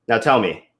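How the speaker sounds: ordinary delivery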